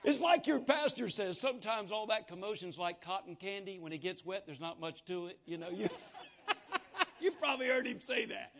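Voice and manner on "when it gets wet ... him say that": ordinary delivery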